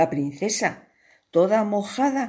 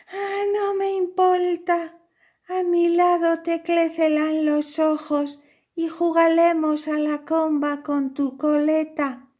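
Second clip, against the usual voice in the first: high princess voice; 10 semitones higher than usual. Acted. Princess asks the painter to marry her, high and sweet. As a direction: play the smitten little princess.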